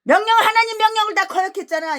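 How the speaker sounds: loud, high accusing cry; preaching shout. Real, not acted. Preacher shouting that Saul defied God's command. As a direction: hurl the accusation.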